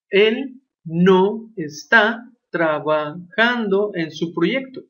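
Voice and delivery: very slow word-by-word translation. Shown as a demonstration. Teacher translating for the class, very slowly. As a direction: drag out each word. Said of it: "He is not working in his project".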